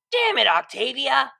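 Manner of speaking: Vinyl's prank is ruined, so she yells at Octavia; exasperated shout. Acted. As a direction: blurt it out as the prank falls apart. Prank foiled, frustrated outburst.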